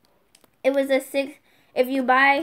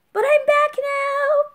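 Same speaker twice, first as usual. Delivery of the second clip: high, loud, drawn-out cheer; big slow squeal of return; triumphant announcement; 13 semitones higher, 7 dB louder, slower than usual. Acted. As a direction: sing out the comeback. Announcing return after long breaks.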